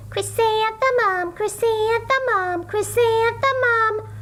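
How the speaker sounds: high, airy sing-song flower voices; dreamy high-pitched chant of her name; flowers calling. A narrator's reading. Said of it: "the flowers seemed to say".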